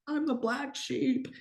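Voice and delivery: high, slow, wistful lament; mock outsider lament. Odd sibling voicing her feelings, high and slow. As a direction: pitch it up and drag it out.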